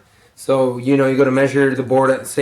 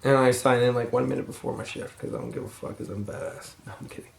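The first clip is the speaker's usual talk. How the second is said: tired mumble; 11 dB quieter, faster than usual. Real, not acted. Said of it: "I'm very tired too".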